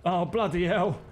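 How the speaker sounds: [name] swearing. Acted. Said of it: "he says".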